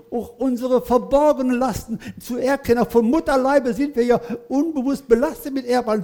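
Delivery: ordinary delivery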